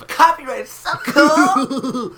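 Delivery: high, slow, loud laughing cry; laughing yell. Acted.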